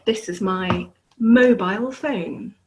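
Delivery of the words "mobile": "mobile" ending like "mile"; British pronunciation. Shown as a demonstration. Teacher shows her own British way, "mobile" as "moh-bile". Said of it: "but here mobile".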